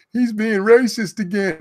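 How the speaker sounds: slow, high mocking voice; mocking whine. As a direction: parody the complainers, drawn out. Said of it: "and they'd be like".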